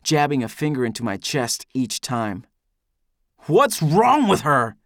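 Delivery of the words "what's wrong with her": slow, high, loud angry roar. A narrator's reading. Demanding answers about Amy, roaring. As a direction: roar the question, stretching it out. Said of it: "I roar".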